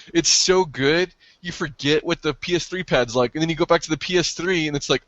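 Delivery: ordinary delivery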